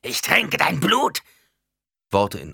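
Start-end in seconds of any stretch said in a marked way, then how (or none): 0.0-1.2 loud, high, drawn-out possessed shriek; screeched curse. A narrator's reading. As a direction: screech it slowly like someone possessed. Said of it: "Schreckliche Flüche drangen über ihre Lippen". (besessen kreischender Fluch)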